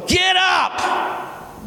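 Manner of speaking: high-pitched shouted order; loud command. Acted. The Lord ordering Joshua up, shouting loudly. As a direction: burst out with a commanding yell. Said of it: "So I said that loudly".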